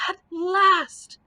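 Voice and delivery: high, loud triumphant roar. Acted. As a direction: let it burst out as a battle cry. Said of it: "roared the knight".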